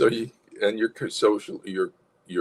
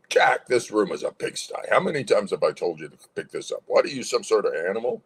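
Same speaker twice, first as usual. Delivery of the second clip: brisk, scolding parent; angry parent. Shown as a demonstration. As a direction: scold a son with angry authority. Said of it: "different tone".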